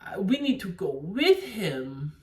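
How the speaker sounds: mocking tired-actress impression; overdone imitation of a lazy line reading; Gal Gadot. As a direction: put on a stilted, accented delivery. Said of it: "she's like".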